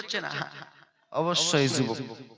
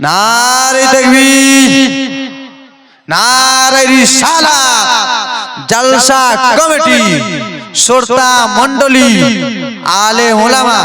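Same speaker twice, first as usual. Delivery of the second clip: very slow, stretched shout of takbir; preacher's rallying cry; drawn-out call to crowd; 5 semitones higher, 9 dB louder, much slower than usual. Acted. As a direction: hold each word long, rallying the crowd. Long drawn-out calls to crowd.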